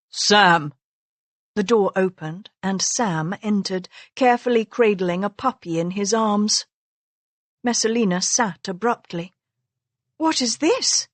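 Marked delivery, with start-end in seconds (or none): 0.1-0.7 raised voice toward a shut door; called out. Acted. Master calls his servant boy through a shut door, raised voice. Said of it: "He raised his voice and called". 10.2-11.1 high, puzzled question rising; confused. Acted. Wife confused as a boy brings in a puppy, high and puzzled. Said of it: "She sounded confused".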